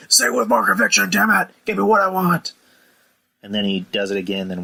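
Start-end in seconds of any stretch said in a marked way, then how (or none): 0.1-2.5 much higher, louder mock yell; hammed-up angry demand. Shane in the announcer's face, high mock yell. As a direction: bark it at him like a bully boss. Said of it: "he gets into his face again and says".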